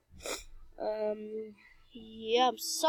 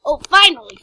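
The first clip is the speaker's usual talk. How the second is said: loud burst of relief; relieved shout; 2 semitones higher, 15 dB louder, faster than usual. Real, not acted. Relief after hard level.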